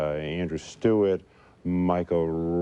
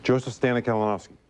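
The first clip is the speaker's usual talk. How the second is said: fast name; 5 semitones higher, faster than usual. Shown as a demonstration. Stutterer shows the TV host his fluent name, fast and higher. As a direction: toss the full name off at speed. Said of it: "I don't have to do that".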